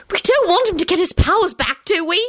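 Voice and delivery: high-pitched alarmed yell. A narrator's reading. Rosie objects to restoring Scully's powers, yelling. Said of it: "she yelled".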